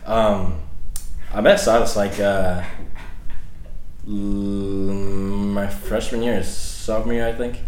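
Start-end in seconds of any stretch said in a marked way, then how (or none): none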